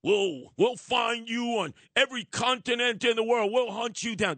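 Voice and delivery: a hint of a tough-guy president; mock Biden boast. Joe Biden flexing at the terrorists, lightly mocked tough talk. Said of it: "there's Joe Biden flexing".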